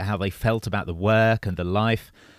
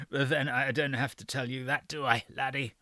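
put-on frail breathless old person; 3 semitones higher, 11 dB quieter than usual. A narrator's reading. Old doctor voiced in story.

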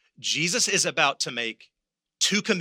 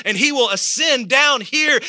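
higher, loud shouted climax; preaching shout; 9 semitones higher, 8 dB louder than usual. Acted. Pastor crying out the second coming to the church, shouting. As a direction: shout the return of the Lord.

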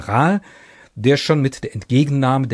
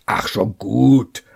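impatient general. Acted. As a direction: brush him off impatiently. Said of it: "bewegte ungeduldig".

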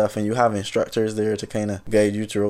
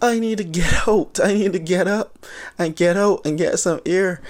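much higher, breathless laughing plea; mock panic; 8 semitones higher than usual. Retelling being pushed under water, high mock-panicked plea. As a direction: play the drowning panic half-laughing.

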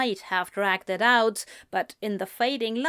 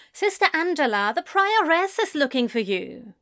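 quick, higher prim call; brisk nun voice; 6 semitones higher, faster than usual. A narrator's reading. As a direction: hurry it like a busy nun. The nun's voice.

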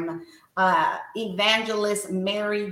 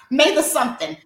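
higher, louder, laughing through the words; 8 semitones higher, 6 dB louder, faster than usual. Real, not acted. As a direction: crack up at your own slip. Laughs at forgetting the date.

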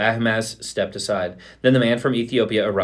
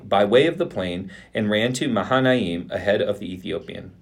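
ordinary delivery, nothing marked

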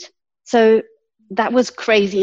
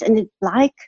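ordinary delivery

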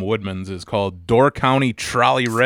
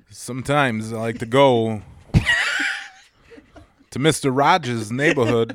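halting impression with long odd pauses; Christopher Walken. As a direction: break the sentence in strange places, like the actor. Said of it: "show him exactly how you would say".

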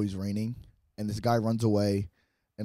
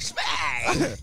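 shouted smack; 4 semitones higher than usual. Retold or imitated. Acted slap at birth.

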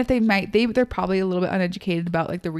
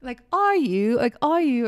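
higher, doubting mock question; untrusting; 6 semitones higher than usual. Brit doubting the reply, imitated untrusting tone. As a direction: question them as if unconvinced. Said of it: "like, untrusting".

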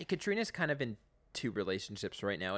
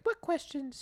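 imitating Katrina; slower than usual. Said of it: "Katrina's like".

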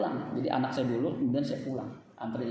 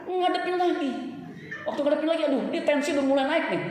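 rising agitation; 11 semitones higher, 3 dB louder than usual. Retold or imitated. Blood pressure rising again.

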